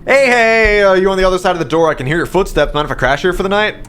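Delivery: high, loud, bubbly greeting through the door; cheerful visitor voice. Acted. Cheerful stranger at the door, voiced high and loud. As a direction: chirp it like an overly friendly stranger. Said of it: "Why are you so cheerful?".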